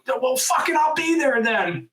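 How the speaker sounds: high, heated shouting; retold outburst. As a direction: yell it back in frantic defiance. Retold outburst at store staff.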